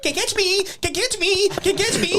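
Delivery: very high, loud mock taunt; imitated taunting. Opponent runs around ring like chicken, high mock taunting. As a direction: chant it squeakily like someone fleeing a chase.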